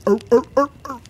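high, loud comic grunts; short repeated sitcom grunts; Tim Allen. Retold or imitated. Host tacking on a Home Improvement reference, imitated grunting. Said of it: "then to Home Improvement via Tim Allen".

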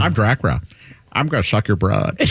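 low mock vampire with a lisp; cat doing Dracula. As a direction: play the cat as a lisping Dracula. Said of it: "she pretends to be Dracula".

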